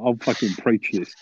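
usual speaking voice

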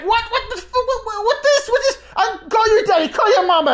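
very high, laughing mock outrage; shrieking parody of the aunt; mimicking the aunt. Aunt freaking out and threatening, very high mock shriek. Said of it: "and she's like".

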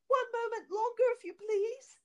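very high-pitched polite pleading; timid plea. A narrator's reading. Wife stalls her angry husband, high timid plea. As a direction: beg sweetly for a little delay.

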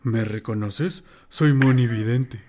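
slow, drawn-out mystic announcer; mock-mysterious slow promo voice; Moni Vidente ad voice. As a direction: intone it slowly like a psychic's radio spot. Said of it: "al final sale de".

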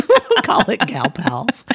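slow, through laughter; laughing. Real, not acted. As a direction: giggle out the silly name. Joking about a cheesy name.

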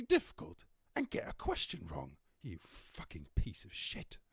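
high, loud, slow contemptuous quizmaster; mock Paxman rant. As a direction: berate the contestant like a scornful host. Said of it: "He's like".

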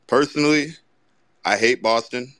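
normal talking voice

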